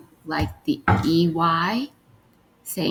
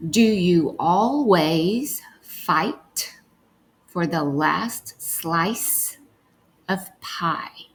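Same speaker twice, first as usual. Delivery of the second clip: slow word-by-word reading for decoding; much slower than usual. Shown as a demonstration. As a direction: read each word slowly, as if sounding it out. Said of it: "let's read".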